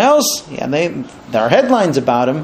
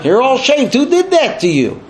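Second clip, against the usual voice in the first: higher-pitched mock outrage; goading wife; 8 semitones higher than usual. Wife reacts to Korach's shaved head, indignant. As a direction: needle him with an indignant question.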